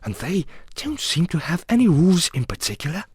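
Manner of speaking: high, plaintive account; complaining. A narrator's reading. Alice telling the cat about the game, complaining. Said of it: "in rather a complaining tone".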